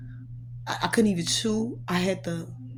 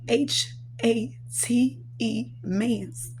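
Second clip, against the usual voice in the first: H A T E spelled letter by letter, slowly; H A T E drawn out letter by letter; 4 dB quieter, much slower than usual. Shown as a demonstration. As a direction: spell out H A T E, each letter apart.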